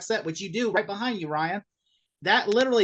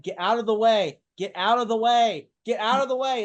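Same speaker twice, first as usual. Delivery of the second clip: louder, urgent repeated warning; yelling at the screen; 5 semitones higher, 6 dB louder than usual. Retold or imitated. Retelling watching The Omen, yelling at the screen. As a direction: shout at the character to move. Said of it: "all of a sudden I'm like".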